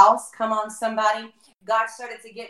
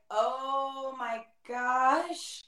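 very drawn-out, softer mock astonishment; stunned disbelief; 2 semitones higher, 6 dB quieter, much slower than usual. Worship leader reacting inwardly to the minister, stunned disbelief. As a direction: stretch each word in retold disbelief.

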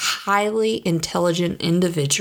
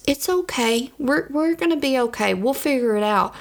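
put-on higher, gentle little-girl voice, soothing; sweet little girl; 8 semitones higher than usual. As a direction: pat the hand and reassure, sweetly. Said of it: "the little girl is just super sweet ... and says".

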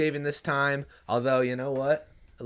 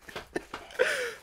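high laugh; 9 dB quieter than usual. Real, not acted. Laughs at Tom's face.

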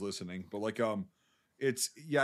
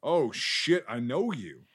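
raised, louder burst of recognition; startled recognition; 2 semitones higher, 7 dB louder, slower than usual. Acted. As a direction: blurt it out as if recognising someone. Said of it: "as he's dying, go".

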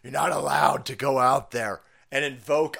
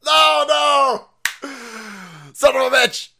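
much higher and louder yell; frustrated shout; 9 semitones higher, 8 dB louder than usual. Real, not acted. Streamer shouting at the replayed clip, loud. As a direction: yell it out in exasperation.

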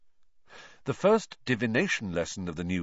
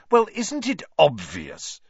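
slow, higher, louder, condescending; superior; 8 semitones higher, 6 dB louder, slower than usual. A narrator's reading. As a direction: talk down to Ron. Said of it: "with a look of maddening superiority".

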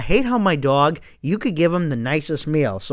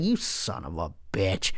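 muttered curse; 9 semitones lower, 11 dB quieter than usual. Retold or imitated. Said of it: "you're like".

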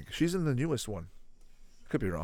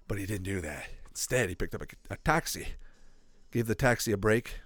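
normal talking voice

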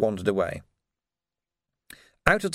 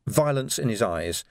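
ordinary delivery

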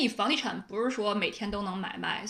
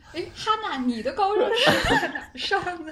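laughing question; 9 semitones higher, 5 dB louder than usual. Real, not acted. Mocking repeat of question, laughing.

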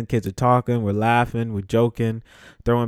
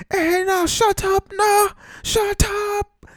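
much higher, slow West Indian accent, mock scolding; hammed-up West Indian accent, much higher; imitated West Indian teacher; 4 dB louder, slower than usual. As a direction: play the frazzled West Indian teacher shushing the class. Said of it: "try to imitate him".

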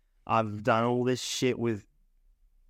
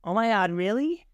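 mock shocked chick; 9 semitones higher, 3 dB louder than usual. Acted role-play as the chick.

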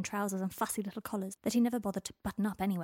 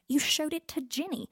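incredulous; 7 semitones higher than usual. Acted. Harry incredulous.

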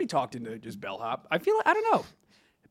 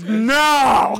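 high, very loud drawn-out mock outrage; pissed-off shout, imitated; 9 semitones higher, 11 dB louder than usual. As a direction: bellow her indignant refusal. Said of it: "she got pissed".

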